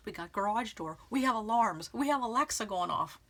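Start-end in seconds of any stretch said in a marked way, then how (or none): none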